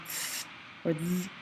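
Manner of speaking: "th or th" as isolated English fricatives; English TH sounds. Shown as a demonstration. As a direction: sound out each "th" on its own, as in English. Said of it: "the T H sound does not make".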